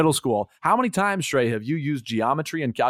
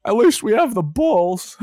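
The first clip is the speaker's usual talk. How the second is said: mock consoling; 9 semitones higher, 6 dB louder than usual. Mock Chicago fan consolation.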